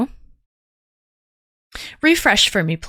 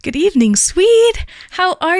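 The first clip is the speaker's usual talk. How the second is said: excited; 9 semitones higher, 5 dB louder than usual. Acted. Greeting after whisper, big lift.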